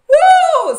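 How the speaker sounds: high, loud laughing shriek. Real, not acted. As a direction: scream with laughter at the burn. Reacting to a harsh insult.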